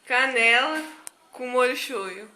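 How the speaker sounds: loud, slow list of ingredients; loud reveal. Real, not acted. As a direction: announce it loudly, drawn out. Revealing the mixture gleefully.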